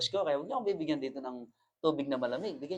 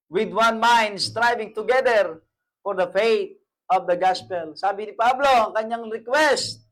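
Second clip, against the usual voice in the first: loud, high, slowed sermon cry; full-force preaching; 6 semitones higher, 9 dB louder, slower than usual. Acted. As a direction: preach it out to the congregation.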